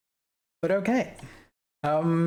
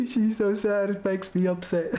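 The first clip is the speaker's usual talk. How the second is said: mock sobbing voice; 5 semitones higher than usual. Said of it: "that's enough crying".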